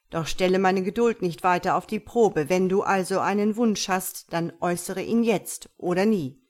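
the djinn losing patience, loud and weighty; commanding djinn. Acted. Dschinn voiced by narrator. (lauter, strenger Dschinn)

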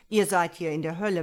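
loud, fast devil's rebuke; growling devil. A narrator's reading. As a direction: rap it out as an impatient devil. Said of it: "Um seinem Knurren Nachdruck zu verleihen". (knurrender Teufel, laut und schnell)

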